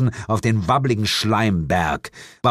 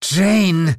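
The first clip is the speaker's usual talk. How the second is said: high, loud drawn-out name; exasperated sigh; 10 semitones higher, 6 dB louder than usual. A narrator's reading. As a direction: sigh the name as a weary demon mother. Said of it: "die große Mutter der Hexen und Dämonen seufzte".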